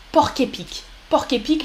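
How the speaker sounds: "porc épic" said as pork-épic, the final C of "porc" clearly heard; porc épic with the C sounded. Shown as a demonstration. Teacher showing how to say porc épic with the C. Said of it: "là, on prononce le C".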